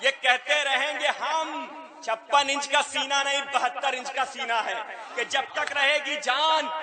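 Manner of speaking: rising, slow chanted recital; poem recitation. Acted. Poet reciting requested lines to the mushaira audience, slow rising recital. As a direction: build the verse toward the refrain.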